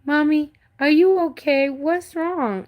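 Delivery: put-on high, worried little girl voice; a small child checking on her mom, voiced up high. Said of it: "She goes".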